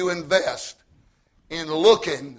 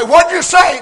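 higher, louder call to another room; 8 semitones higher, 9 dB louder than usual. Retold or imitated. Husband calling to his muttering wife in kitchen, loud. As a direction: shout the question toward the kitchen.

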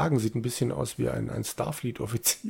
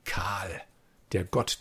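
dramatic whisper; 4 semitones lower, 5 dB quieter, much slower than usual. Acted. Dramatic reveal of god's name.

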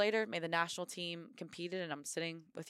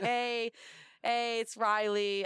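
bright, slower, louder self-assured phone intro; bold confident call; 5 semitones higher, 4 dB louder, much slower than usual. Retold or imitated. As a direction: sell yourself to the coach with swagger. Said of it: "And I said".

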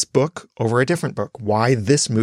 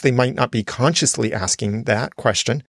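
ordinary delivery

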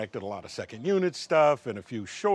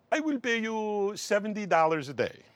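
put-on higher pitched strange accent; earnest odd foreign lilt; Tommy's odd accent; 9 semitones higher than usual. Tommy offering a tiny day rate, in his strange accent. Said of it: "with the craziest accent that you have ever heard".

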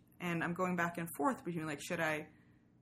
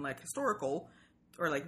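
usual speaking voice

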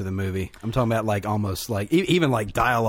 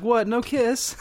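high, pouting mock girl's plea; 11 semitones higher, much slower than usual. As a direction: pout it like a jilted girl. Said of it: "she's like".

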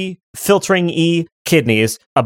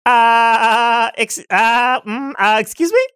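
high, flustered creature stammer; taken aback; 8 semitones higher, much slower than usual. Acted. Kidney hearing one will be removed, flustered and aghast. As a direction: falter in high-pitched disbelief.

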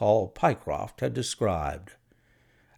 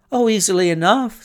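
bright, higher cheerful reply; eager clerk, cheerful and higher; cheerily; 8 semitones higher, 5 dB louder, faster than usual. A narrator's reading. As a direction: answer cheerily, pitched up and a bit louder. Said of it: "said Hall Pycroft cheerily".

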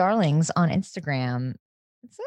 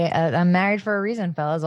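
normal talking voice

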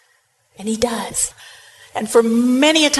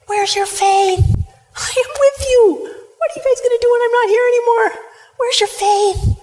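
higher, urgent questioning of his friends; pleading rebuke; 13 semitones higher, 3 dB louder than usual. Acted. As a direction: press them, rising and insistent. Jesus rebukes disciples.

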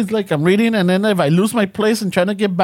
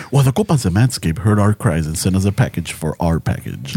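low radio ad read; announcer voice; 12 semitones lower than usual. Retold or imitated. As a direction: deliver it as a sponsor spot. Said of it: "my announcer voice".

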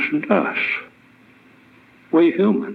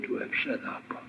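whisper; 15 dB quieter than usual. Acted. Preacher drops to a whisper.

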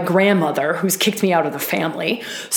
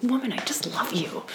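tender whisper; 3 semitones higher, 10 dB quieter than usual. Real, not acted. Tender aside to a circle member.

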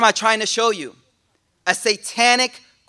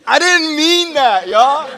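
high, loud, slow plea; comic plea; 5 semitones higher, 6 dB louder, slower than usual. Retold or imitated. Umar regretting his words, hammed-up loud plea. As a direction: whine it out, embarrassed. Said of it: "Every time Umar would think".

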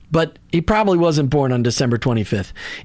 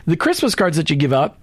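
usual speaking voice

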